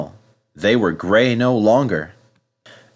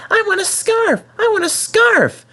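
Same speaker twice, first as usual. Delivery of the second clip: much higher, whiny little engine chant; Percy nagging. Acted. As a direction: nag and repeat it like a spoilt child.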